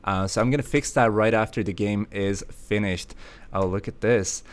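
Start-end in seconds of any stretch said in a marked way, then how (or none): none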